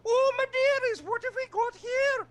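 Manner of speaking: very high, screechy hammed-up crone; cackly old witch, way over the top and drawn out. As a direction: do a ridiculous high-pitched crone accent. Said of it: "if you run into the old crone".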